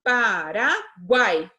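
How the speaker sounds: slow, syllable by syllable; teacher spelling out country names for pupils. Shown as a demonstration. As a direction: split each word into its syllables. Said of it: "Guai é uma sílaba só".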